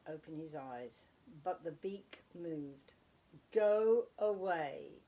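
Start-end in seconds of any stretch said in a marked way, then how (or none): 3.5-5.0 slow, loud, drawn-out grumpy dismissal; sleepy father. Acted. Father owl brushes off his son, slow grumpy growl. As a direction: grumble it out half asleep.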